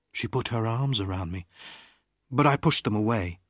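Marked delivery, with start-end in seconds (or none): none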